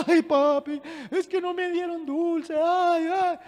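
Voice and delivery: high, whiny kid's wail, put on; hammed-up tearful little-kid complaint; mock crying child. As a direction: imitate a child whimpering to daddy. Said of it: "lleva cinco minutos llorando".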